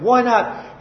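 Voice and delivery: normal talking voice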